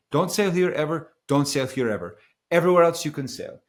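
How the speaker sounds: put-on loud, high-pitched Italian accent; emphatic imitated Italian skipper. Skipper warning a sailor off certain waters, loud Italian accent. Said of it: "stop with the Italian accent".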